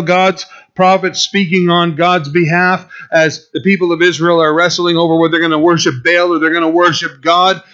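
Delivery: usual speaking voice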